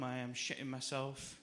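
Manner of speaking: ordinary talk, nothing marked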